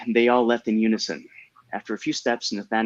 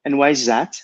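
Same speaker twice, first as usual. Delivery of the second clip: put-on higher, louder girlish question; little French girl; 5 semitones higher, 5 dB louder than usual. A narrator's reading. Girl questions Daniel's apology, higher little-girl voice. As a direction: ask it as a curious French child. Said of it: "asked the little girl".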